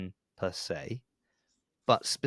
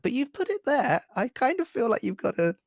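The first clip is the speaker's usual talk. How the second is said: pleading. Retold or imitated. Retold arguing with shop staff.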